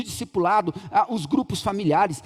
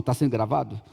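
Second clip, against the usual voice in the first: ordinary delivery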